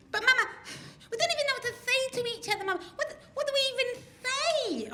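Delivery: put-on high, fast, whining kid voice; little kid pleading with mum; whiny child. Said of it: "They were like".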